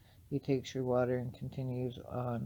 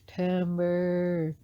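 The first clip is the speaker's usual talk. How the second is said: long, high, loud "timber" call; raised-voice elder's warning yell; 6 semitones higher, 8 dB louder than usual. A narrator's reading. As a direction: call it out loudly, drawing the word out. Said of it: "The elder yells out".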